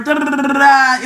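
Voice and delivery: rapid rolled trill ending on an open "da"; imitated fanfare noise. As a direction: rattle out a mock fanfare. Mimics noisy online self-promotion.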